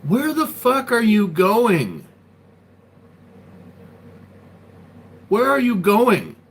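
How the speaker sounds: high, slow, full-force confrontational shout; angry yell. Acted. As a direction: scream the challenge, dragging out the words. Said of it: "I'll reenact what happened".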